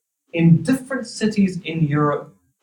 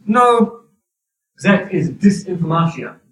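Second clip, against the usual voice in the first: louder, higher mock Russian official, bad Russian accent; 4 semitones higher, 4 dB louder than usual. Kremlin brushing off the US and UK, in a bad Russian accent. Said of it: "That's my really bad Russian accent there".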